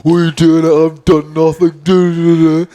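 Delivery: shouting denial; drunk indignant outburst. Retold or imitated. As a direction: act out a drunk mate's outrage. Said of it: "Luke's like".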